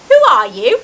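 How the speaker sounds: loud, swooping astonished question; startled blurt. A narrator's reading. Harry to the giant, blurted out loudly in surprise. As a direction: blurt it out in disbelief.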